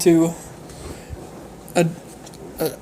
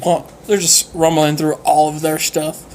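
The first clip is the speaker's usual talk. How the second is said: usual speaking voice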